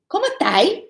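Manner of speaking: high, clipped-ending "¿Cómo 'tai?"; "estás" shortened to "tai", pitched high; Chilean colloquial form. Retold or imitated. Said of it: "They didn't say".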